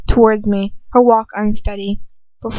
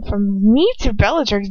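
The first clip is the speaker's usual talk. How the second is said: ordinary delivery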